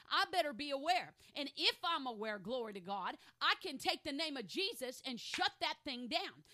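loud, emphatic sermon delivery; forceful preaching. Real, not acted. Preacher declaring power over the enemy, loud and forceful. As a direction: preach it with conviction, punching key words.

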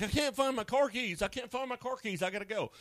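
high, fretful mock panic; panicky whiner. A person making a crisis out of lost keys, high whiny panic. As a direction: play someone melting down over keys. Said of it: "It's like".

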